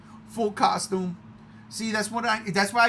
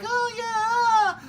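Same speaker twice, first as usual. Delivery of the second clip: high teary oh yeah; hammed-up crowd; mock lukewarm crowd. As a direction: imitate a mild crowd reaction. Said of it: "so they're like".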